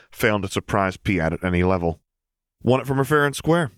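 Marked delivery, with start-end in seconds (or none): 2.6-3.7 innocent. A narrator's reading. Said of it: "a slightly too bright beacon of innocence".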